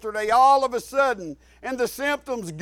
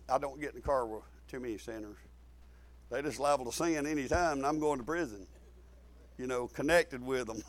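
much lower, very soft and quicker; low aside; 11 semitones lower, 14 dB quieter, faster than usual. Real, not acted. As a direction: drop right down into an offhand, hushed aside. Confiding aside after correcting himself.

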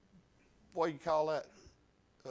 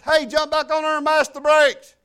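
loud, raised-pitch shouted instruction; retold urgent call; 5 semitones higher, 8 dB louder than usual. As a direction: holler it to someone jumping off. Calls to boy leaving moving vehicle.

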